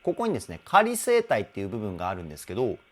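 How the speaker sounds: usual speaking voice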